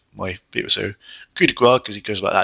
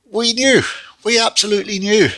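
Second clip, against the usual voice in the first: much higher, exasperated we-knew-it; knowing groan of disbelief; 9 semitones higher than usual. Real, not acted. Fan reviewing the match result, high exasperated disbelief. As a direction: pitch it up in weary disbelief. Fans' dread confirmed at the count.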